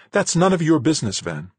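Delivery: high, loud woman's retort; indignant rebuff. Acted. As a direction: shut him down, indignant. Acted quarrel, woman snaps back.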